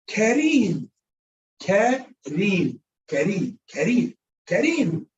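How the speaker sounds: Kareem with a light tapped R before a long ee; soft Arabic R. Shown as a demonstration. Teacher showing students the Arabic name, soft R. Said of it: "that is pronounced soft".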